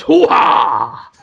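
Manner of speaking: loud, gruff bad Pacino hoo-ha; Al Pacino impression. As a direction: bark it like a failing Pacino. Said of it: "I can't do him at all".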